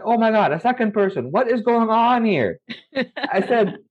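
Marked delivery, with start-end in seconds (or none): 0.1-2.6 stunned disbelief. Retold or imitated. Second person says jinn sent him.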